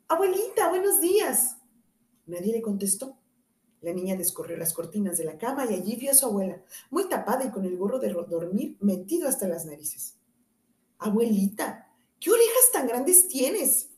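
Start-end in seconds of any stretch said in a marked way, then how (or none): 0.1-1.6 put-on high, sweet child's greeting; the girl chirps hello to her granny; little girl greeting. Caperucita greets her grandmother, high and sweet. 11.0-13.9 put-on high, slow, wide-eyed child's question; wondering little girl. Caperucita asks her grandmother, slow and amazed.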